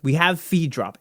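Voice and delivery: ordinary delivery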